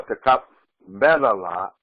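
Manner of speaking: Takabbal drawn out slowly, each syllable given its full length; teacher shows students the proper slow greeting; slow and careful. Shown as a demonstration. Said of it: "But it's".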